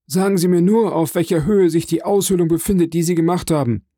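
high-pitched, hurried questioning; the hidden prisoner's quick high voice; speaking quickly. A narrator's reading. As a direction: press urgently for a precise detail. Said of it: "versetzte die Stimme schnell sprechend".

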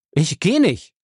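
high, loud defiant refusal; stubborn. Acted. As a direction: dig in and refuse him. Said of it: "sagte Ray störrisch". (störrisch, trotzig)

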